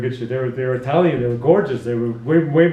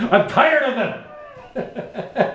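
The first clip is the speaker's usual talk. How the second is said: high, drawn-out mock exasperation; hammed-up outburst breaking into laughter; mock intense; 7 semitones higher, 3 dB quieter, much slower than usual. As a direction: imitate a fed-up flatmate. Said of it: "like, all intense".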